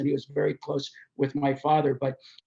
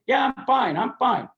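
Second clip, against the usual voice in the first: higher-pitched, insistent mock reassurance; brushing off concern; 7 semitones higher, 4 dB louder than usual. Limping Evel answering a worried friend, acted dismissal. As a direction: wave the worry away firmly.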